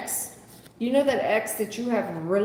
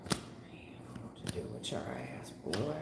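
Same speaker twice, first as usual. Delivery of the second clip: muttered under the breath, much softer and lower; exasperated whisper; 14 dB quieter, slower than usual. Real, not acted. Reader despairing of Raymond, whispering under her breath. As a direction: hiss it to yourself in disbelief.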